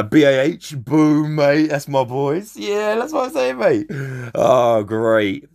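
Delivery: ordinary delivery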